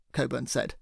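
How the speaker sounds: ordinary delivery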